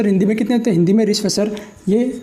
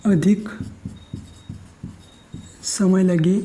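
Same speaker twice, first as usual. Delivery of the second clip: slow, word by word with long pauses; slow dictation; slower than usual. Shown as a demonstration. Teacher dictating a definition for students to copy, slow and spaced. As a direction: dictate it slowly so students can write.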